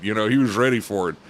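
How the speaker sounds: usual speaking voice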